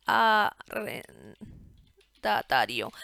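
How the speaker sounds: drawn out very slowly while typing; slow typing dictation. Shown as a demonstration. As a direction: spell it out slowly as you type. Typing the field name aloud.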